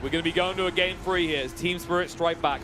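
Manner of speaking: loud, high caster call; excited play-by-play. Real, not acted. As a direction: call the game-ending moment. Live play-by-play, series tied.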